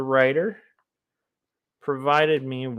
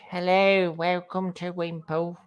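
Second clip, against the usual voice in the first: higher, put-on British accent greeting; 6 semitones higher than usual. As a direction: play a cheery British host. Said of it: "I was doing some British accents".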